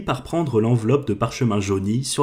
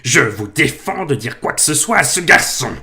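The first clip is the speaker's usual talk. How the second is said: outraged uncle; 5 semitones higher, 5 dB louder than usual. Acted. Uncle forbids the giant.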